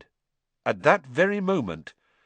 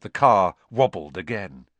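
ordinary delivery, nothing marked

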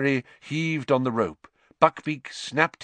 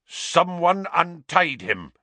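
slow, higher angry snarl; 7 semitones higher, 3 dB louder, slower than usual. Acted. Executioner accusing an unknown thief, slow snarl. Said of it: "the executioner was snarling".

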